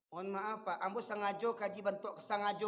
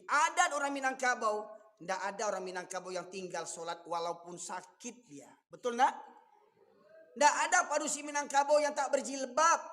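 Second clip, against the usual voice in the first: louder, driving sermon lines; forceful preaching; 3 semitones higher, 6 dB louder than usual. Real, not acted. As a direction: press the point home. (ceramah berapi-api)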